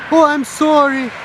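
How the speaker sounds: high, drawn-out mock apology; fake apology. American saying sorry while not meaning it, mock-sweet and high. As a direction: apologize with exaggerated, insincere politeness.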